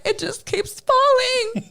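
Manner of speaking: high, slow, haunted wail; film character quote. Retold or imitated. Quoting the Craft girl in the bathroom, high wailing lament. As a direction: quote the Craft scene, eerie and drawn out. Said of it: "the bitch is in the bathroom and she's like".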